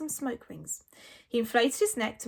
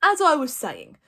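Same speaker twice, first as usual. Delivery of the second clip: gruff chieftain; 3 semitones higher, 8 dB louder than usual. A narrator's reading. Stoic resuming.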